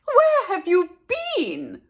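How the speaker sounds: high, slow loud scold; mother scolding her missing daughter. Acted. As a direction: demand it, drawn out.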